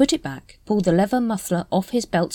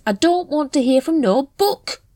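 growling; 10 semitones higher, 5 dB louder than usual. A narrator's reading. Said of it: "growled Nobby".

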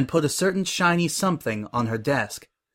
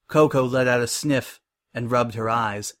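usual speaking voice

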